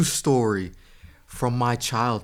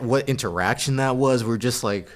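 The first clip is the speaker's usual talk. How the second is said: usual speaking voice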